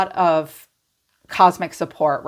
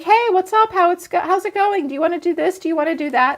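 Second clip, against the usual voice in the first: high, chirpy, eager greeting; you, looking amazing, chirping high invitations; bubbly friend; 11 semitones higher, faster than usual. Retold or imitated. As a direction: play the glowing one inviting everyone. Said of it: "and you're like".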